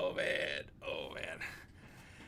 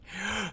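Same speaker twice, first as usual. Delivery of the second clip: playful gasp; 9 semitones higher, 4 dB quieter than usual. Pretend. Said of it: "kinda like".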